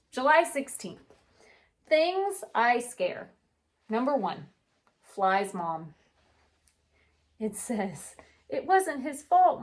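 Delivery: gentle storybook read-aloud; unhurried picture book narration; reading to children. A narrator's reading. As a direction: read it like story time for kids.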